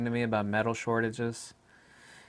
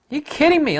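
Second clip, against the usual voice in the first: loud and much higher, mock disbelief; incredulous; 7 dB louder than usual. Hearing of outdoor mask law, loud high incredulity. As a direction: throw up your hands in a loud high squawk.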